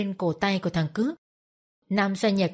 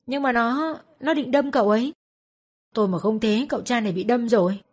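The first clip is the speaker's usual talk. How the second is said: higher, protesting excuse; defensive excuse; 3 semitones higher than usual. A narrator's reading. Wife defends herself to her husband, protesting. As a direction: plead your case. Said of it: "Bà vợ chống chế".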